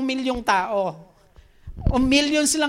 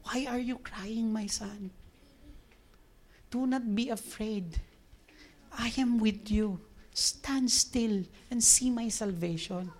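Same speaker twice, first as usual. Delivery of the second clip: soft, slow, tender reassurance; comforting; 8 dB quieter, slower than usual. Acted. The Lord to his crying son Moses, soft and comforting. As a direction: console gently, leaving room between phrases. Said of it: "Hindi siya pinagagalitan".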